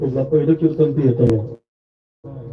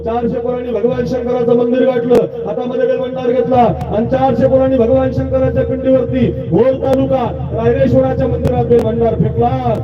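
preaching at full force; 10 semitones higher than usual. Acted. Kirtankar to village crowd about Maratha heroes, impassioned high preaching.

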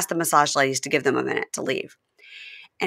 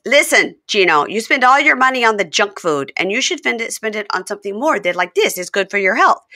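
mock Jasmine lecturing; 5 semitones higher, 4 dB louder than usual. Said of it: "And she goes".

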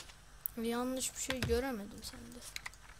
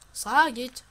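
loud order; 2 semitones higher, 15 dB louder than usual. Acted.

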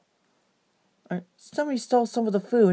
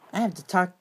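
usual speaking voice

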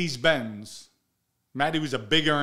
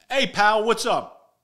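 loud, higher challenge into the phone; loud higher challenge down the phone to a yelling man; confrontational call out; 8 semitones higher, 9 dB louder, slower than usual. Retold or imitated. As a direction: call him out hard, loud and high. Confronting yeller over phone.

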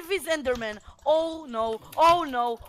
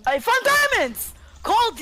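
triumphant shout; 12 semitones higher than usual. Real, not acted. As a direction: shout your lucky find to the team. Triumphant find in the game.

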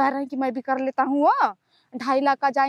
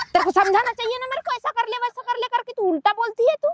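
frustrated scolding; 8 semitones higher than usual. Real, not acted. Frustrated daughter scolding mother.

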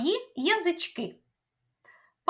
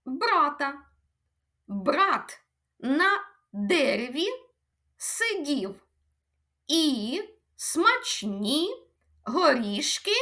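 word by word. Shown as a demonstration. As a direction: lay each word out for counting. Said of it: "А тепер слухаємо і рахуємо". (повільно, слово за словом)

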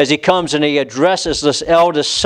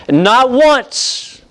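brazen boast; 8 semitones higher than usual. Acted. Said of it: "He shows them the brazen attitude".

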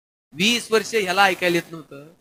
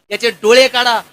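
firm order, louder than the lines around it; royal command; 7 dB louder than usual. Retold or imitated. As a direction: decree it like a king passing sentence. King's order quoted in speech.

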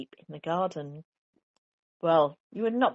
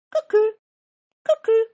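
very high, loud cuckoo; cuckoo call; 14 dB louder than usual. A narrator's reading. Cuckoo clock chiming loudly in the living room.

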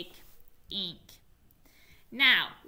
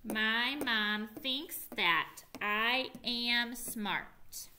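slow choral reading; much slower than usual. Shown as a demonstration. Teacher leading the class in a slow choral read. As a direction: read slowly word by word. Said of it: "can you read the sentence with me".

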